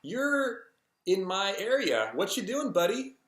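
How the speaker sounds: high-pitched, pointed confrontation; not mean, not nice. Retold or imitated. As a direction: challenge an intruder without being nasty. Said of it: "not like a mean way to say it, but not a nice way either".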